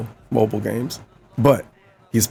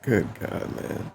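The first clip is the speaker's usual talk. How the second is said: breathy whispered exclamation; under your breath; whispering; 3 semitones lower, 13 dB quieter, slower than usual. Real, not acted. Whispered aside.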